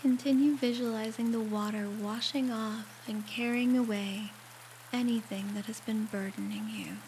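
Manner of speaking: guided meditation. Acted. Guiding a listener through a shower meditation, calm and unhurried. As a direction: ease the listener gently through each step, leaving space to breathe.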